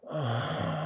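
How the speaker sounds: high, voiced sigh of pleasure; intoxicated vampire sigh; rapturous sigh. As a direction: sigh as if savouring a scent. Savouring memory of the hunt.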